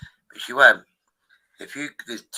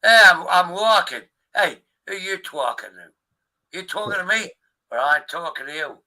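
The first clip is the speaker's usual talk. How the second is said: higher-pitched mock New York accent; New York tough guy; 8 semitones higher, 6 dB louder than usual. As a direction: challenge someone in a hammed-up New York accent. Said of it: "Taxi driver".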